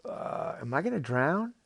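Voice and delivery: falter, then pitch the worry up; worried boy. Acted. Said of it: "And Jordan's like".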